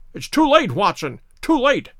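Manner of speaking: dismayed cry, starting high and falling. A narrator's reading. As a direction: cry out as if all is lost. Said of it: "cried Holmes".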